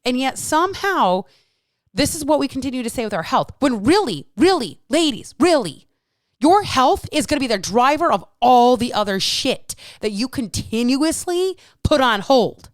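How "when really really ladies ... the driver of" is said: louder, higher, pushing each really; rising insistent really; emphatic appeal. Real, not acted. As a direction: drive it home to the ladies.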